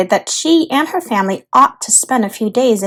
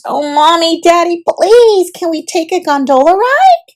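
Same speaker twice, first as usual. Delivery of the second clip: high, louder pleading, slowed on please; begging; 6 semitones higher, 3 dB louder than usual. Acted. Olivia begging her parents for a gondola ride, pleading. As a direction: beg sweetly. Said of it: "Olivia begged her parents".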